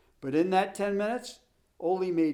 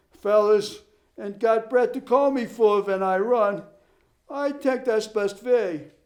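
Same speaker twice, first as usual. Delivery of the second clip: put-on Swedish accent; 5 semitones higher, 6 dB louder than usual. Ole's immigrant dialect voice.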